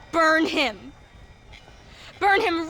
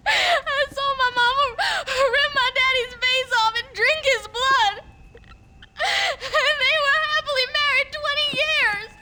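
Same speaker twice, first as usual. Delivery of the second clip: crying shout; 7 semitones higher than usual. Acted. Boy recounts mother killing father.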